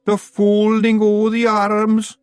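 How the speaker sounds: high, slow Scots wailing; a terrified wee man crying out; panicked cry. A narrator's reading. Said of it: "cried Daft Woolly".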